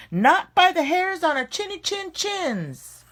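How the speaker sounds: high, squeaky piggy refusal; little pigs' voice. Acted. Little pigs refusing the shark, high and defiant. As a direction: chirp it back up high, defiant.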